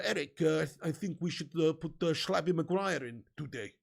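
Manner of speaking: a hint of a mock Dutch accent, lightly done; fake Dutch accent. Vlogger imitating a coach talking to Ten Hag, mild mock Dutch accent. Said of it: "that fake Dutch accent behind the scenes".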